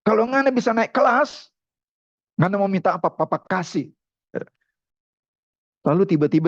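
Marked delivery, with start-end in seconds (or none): 0.1-3.9 higher-pitched, mildly irritated father; grudging promise through annoyance; annoyed. Retold or imitated. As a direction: talk to the son with acted exasperation. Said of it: "dengan kesel sebetulnya".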